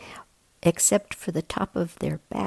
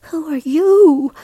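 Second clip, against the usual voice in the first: bear cub asking; 11 semitones higher, 10 dB louder than usual. A narrator's reading. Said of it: "Lars asked".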